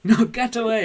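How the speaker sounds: loud, high mock shout at the dog; shooing the dog. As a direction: yell at the dog to get away. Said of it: "You're like".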